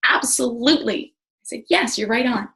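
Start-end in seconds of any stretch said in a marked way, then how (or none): 0.1-1.1 higher, louder mock-gushing reply; retold gleeful agreement. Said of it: "big grin on my face, big eyes". 1.7-2.5 higher, louder emphatic reply; retold excited agreement. Retelling her gleeful agreement.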